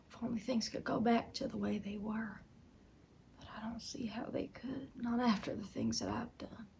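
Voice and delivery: remorse fading into a near whisper; hushed regret. Acted. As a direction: confess it very softly, almost without voice. Remorseful confession to husband.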